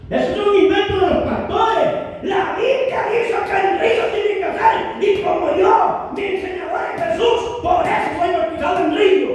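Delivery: impassioned pulpit proclamation; preaching at full force. Acted. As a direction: preach it to the back row. Preaching against pastors.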